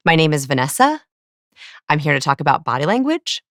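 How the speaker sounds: "Vanessa" and "language" rise at the end, like questions; accidental question inflection. Shown as a demonstration. Demonstrating a self-introduction, "Vanessa" and "language" turned into questions. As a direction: state it, but lift "Vanessa" and "language" into questions. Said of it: "it's called the question inflection".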